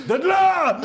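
loud high mock yell; mock shout. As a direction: shout it like a wake-up. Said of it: "Léo qui fait".

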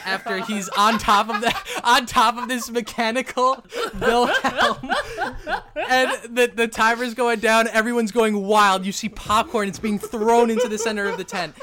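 high-energy scene setting; excited game-master narration. Acted. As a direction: call the mayhem like a rowdy event.

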